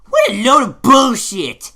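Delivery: loud, high, drawn-out indignant shout; exasperated shout; angry outburst. Acted. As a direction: yell it out in disgust, stretching the words. Reviewer's outburst at episode's moral.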